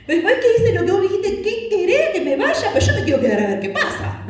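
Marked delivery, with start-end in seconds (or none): 1.4-4.3 high, giggly mock protest; laughing Elijah voice. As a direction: play Elijah laughing, wanting to stay. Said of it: "habrá dicho Elías".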